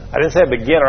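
normal talking voice

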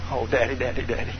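soft, fearful pleading with dad; frightened child pleading softly; scared whimper. Acted. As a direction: beg quietly, afraid of a whipping.

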